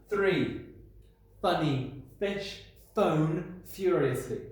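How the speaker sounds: funny fish phone furiously said slowly with every f leaned on; slow, stressed f sounds. Shown as a demonstration. Teacher modelling alliteration for pupils. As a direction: lean on each f. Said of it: "It's a funny language".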